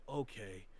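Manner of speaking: lightly voiced betrayed villain; hurt villain. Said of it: "That man went from being like".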